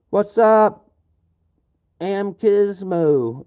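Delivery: loud, high, excited hello to a viewer; excited greeting. Real, not acted. Streamer loudly greets Amkismo, excited. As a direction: shout a stretched-out welcome.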